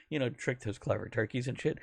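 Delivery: ordinary talk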